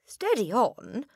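put-on high, drawn-out boy's protest; puzzled boy. A narrator's reading. Boy protesting to his headmistress, high-voiced and puzzled. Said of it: "more puzzled than ever".

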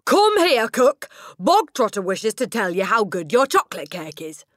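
high, very loud summons; shouting. A narrator's reading. Headmistress summoning the cook to the platform, shouting. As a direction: call it out to someone far away. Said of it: "the Trunchbull shouted".

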